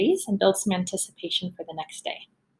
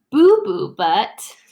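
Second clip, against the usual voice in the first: silly drawn-out name; 3 semitones higher, 6 dB louder, much slower than usual. Acted. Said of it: "makes silly sounds like".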